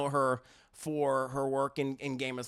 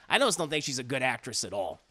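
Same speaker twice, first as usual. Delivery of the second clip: ordinary talk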